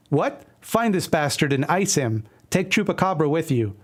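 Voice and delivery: ordinary delivery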